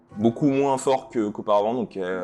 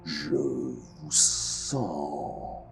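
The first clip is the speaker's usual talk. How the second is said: deep, slow, booming guardian voice; low and slow, echoing through the city; cavernous echoing voice; 4 semitones lower, 5 dB quieter, much slower than usual. Acted. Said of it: "une voix caverneuse qui a l'air de résonner".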